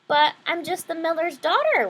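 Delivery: usual speaking voice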